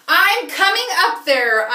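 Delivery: loud, slow announcement; determined. Acted. Lila calling up to the hippo, loud and determined. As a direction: declare it to the hippo.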